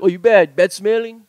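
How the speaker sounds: mock insult in Hawaiian pidgin. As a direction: put on pidgin and punch it. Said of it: "that's an insult".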